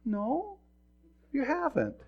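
high, small-voiced admission; acted sheepish reply; Balaam conceding. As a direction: answer high and without force, caught out. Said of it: "looks at his donkey and says".

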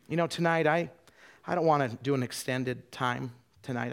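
ordinary delivery

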